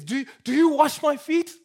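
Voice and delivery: emphatic shocked question. Retold or imitated. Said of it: "in what Peter says".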